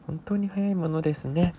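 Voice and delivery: ordinary talk, nothing marked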